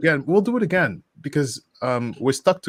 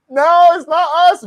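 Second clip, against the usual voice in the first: a much higher, louder, slow squeaky denial; mock innocent; 8 dB louder than usual. Caught in the back of a car, high mock denial. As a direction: play innocent, pitched way up. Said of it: "look at me".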